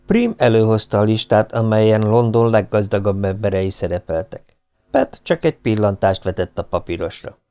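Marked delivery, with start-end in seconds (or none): none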